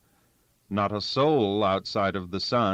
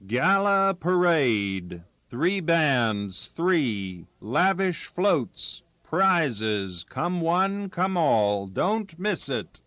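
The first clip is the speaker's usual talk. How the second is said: higher, slow barker-style announcement; headline pitch; 7 semitones higher, slower than usual. Acted. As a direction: sell it like a fairground barker, slowly. Newspaper headline read out.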